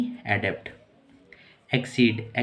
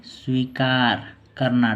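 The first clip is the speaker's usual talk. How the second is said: drawn out slowly, word by word; slow dictation; much slower than usual. Shown as a demonstration. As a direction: spell it out slowly so students can write. Teacher dictating meaning to write. (धीरे-धीरे लिखवाते हुए)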